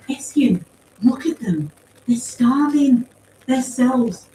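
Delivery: earnest pleader. A narrator's reading. Paz argues for the starving survivors, earnest appeal. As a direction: plead the case for struggling survivors.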